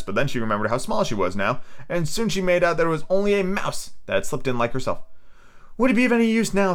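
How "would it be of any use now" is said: put-on much higher girlish storybook voice; high-pitched story heroine; voiced Alice. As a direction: play little Alice, pitched well up.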